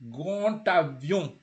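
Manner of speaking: GRAND AVION slow, linked with a T; D sounded as T. Shown as a demonstration. Teacher shows the connection in GRAND AVION, D as T. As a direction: stretch GRAND AVION, the D turned to T. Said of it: "it will sound like a T".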